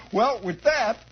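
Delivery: normal talking voice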